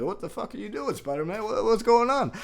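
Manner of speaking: high-pitched, bewildered yelp; mock terrified teenager. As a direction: act out a panicked kid being carried off. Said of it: "these terrified young teenagers".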